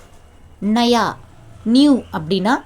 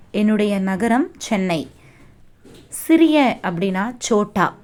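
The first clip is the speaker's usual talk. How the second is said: usual speaking voice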